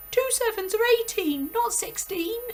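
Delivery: put-on high, loud little boy cry; a small boy blurting a panicked guess. Rupert frantically corrects himself to the headmistress, high and loud.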